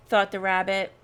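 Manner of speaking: plain reading voice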